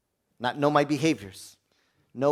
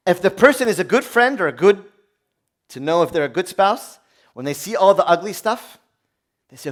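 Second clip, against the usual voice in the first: ordinary talk, nothing marked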